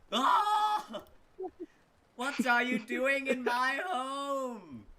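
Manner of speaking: high, loud shriek, slow and drawn out; scream. Acted. Woman screams at the soldier who crashes into her room, a long high shriek. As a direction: shriek in fright at the intruder. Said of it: "she just sort of screams".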